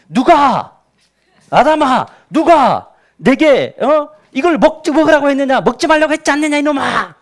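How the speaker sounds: loud, high furious roar at Adam; angry God. Acted. God confronting Adam over the forbidden fruit, angry. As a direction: bellow an enraged interrogation, building up. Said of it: "화가 났죠".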